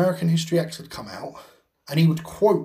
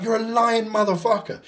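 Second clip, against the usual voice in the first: incredulous retort; 6 semitones higher than usual. Retold or imitated. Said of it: "It was like".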